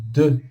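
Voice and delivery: "de" as deux, E through rounded lips; French pouted vowel. Shown as a demonstration. Teacher showing the word for two, pouted pronunciation demo. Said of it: "Olha o biquinho".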